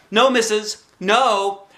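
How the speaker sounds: put-on quick little-girl protest; hasty denial. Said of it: "I said".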